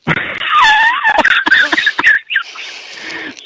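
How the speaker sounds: piercing high laughing shriek. Real, not acted. Telling cohosts the scary story, shrieking laugh. After the eerie voice.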